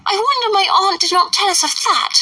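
put-on high, eager girl's call; high excited young girl; giddy Lydia. A narrator's reading. Lydia voiced by reader.

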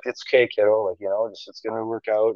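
put-on soft, hushed words to steady a nervous kid; calm reassurance. Dad steadying his shaking daughter before the shot, soft and calm. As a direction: talk her down quietly and evenly. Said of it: "trying to be cool hand Luke and just be like".

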